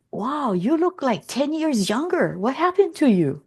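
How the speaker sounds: high, swooping imitation of astonishment; retold wow of disbelief; amazed. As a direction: act out amazed relatives. Relatives' amazed reaction, acted out.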